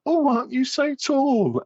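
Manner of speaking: put-on high, slow old lady voice. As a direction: coo it like a sweet old lady. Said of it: "that's my old lady voice".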